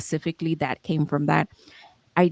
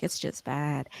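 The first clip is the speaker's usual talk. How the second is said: quiet dread; 2 semitones lower, 9 dB quieter, slower than usual. Retold or imitated. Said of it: "You're like".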